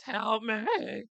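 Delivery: loud, high acted scream; cry for help. Hunted rabbit begging to be saved, cry for help. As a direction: shout it high, drawing it out. Hunted victim calling out in manga.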